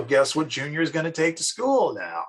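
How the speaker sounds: usual speaking voice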